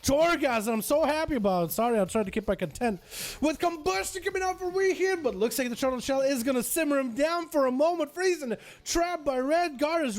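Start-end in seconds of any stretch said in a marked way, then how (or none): 3.4-10.1 raised-pitch esports commentary; excited match caster; play-by-play. Acted. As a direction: call the live action in a lifted voice. Live play-by-play cast of match.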